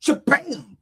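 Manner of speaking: high, punched "Shabam"; imitated impact. Preacher imagining passing out, mock thud. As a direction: burst out a mock crash noise. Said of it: "Maybe I'll fall forward".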